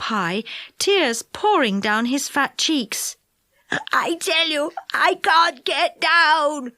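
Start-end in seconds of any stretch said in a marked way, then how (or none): 3.9-6.7 tearful. A narrator's reading. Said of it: "he kept saying".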